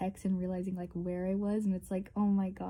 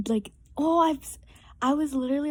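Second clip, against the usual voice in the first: excited; 5 semitones higher, 7 dB louder, faster than usual. Real, not acted. Excited rant, loud laugh.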